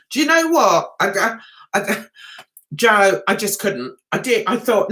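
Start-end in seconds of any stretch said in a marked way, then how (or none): none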